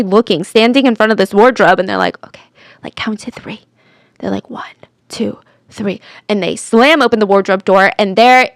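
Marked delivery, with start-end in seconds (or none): 2.3-3.6 very soft, half-whispered; hushed count. Retold or imitated. Police silently readying at the wardrobe, hushed. 4.5-6.0 soft, half-whispered countdown; hushed count. Retold or imitated. Police counting before the wardrobe opens, hushed.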